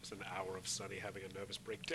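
hushed joking aside; whispering. Real, not acted. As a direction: breathe it out as a whisper. Whispered quip to co-hosts.

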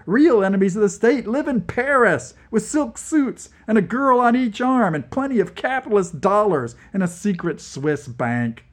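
high, loud indignant rant; indignant shout. Acted. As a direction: keep ranting at full volume. Continuing indignant outburst.

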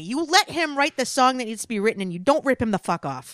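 mock rant. Ranting about paying [name].

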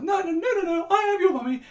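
a dad playing a comic mum who won't be doubted; insisting in a hammed-up high mummy voice; silly put-on lady voice. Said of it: "affected a stupid female accent".

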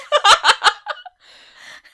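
loud, high burst of laughter; big shrieking laugh; shocked laugh. Real, not acted.

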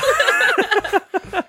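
squeaky cartoon giggle.

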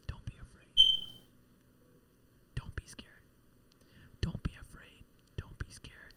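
very soft, breath-only whisper with pauses; loving whisper. Acted. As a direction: whisper reassurance like an angel at the ear. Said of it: "whisper in your ear lovingly".

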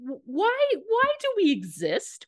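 high, baffled mock despair; bewildered. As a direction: wail it like someone utterly lost. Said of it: "you're going like".

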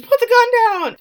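high, loud yell; coach screams. Retold or imitated. The coach yelling at Travis to put the gun down, screaming. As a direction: scream it in alarm, full force. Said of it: "Coach screams".

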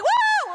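very high, loud "Woo!"; party whoop. Retold or imitated. Speaker dancing at weddings, party whoop. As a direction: whoop it up on the dance floor.

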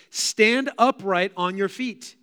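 loud, slow, drawn-out command; ringing scriptural command, each word stretched. A narrator's reading. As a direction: proclaim it slowly to the whole room. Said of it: "said in a loud voice".

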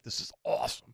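put-on a thrilled kid breathing it out under his breath; hushed excitement. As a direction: whisper it like a secret thrill. Kid thrilled at night trip.

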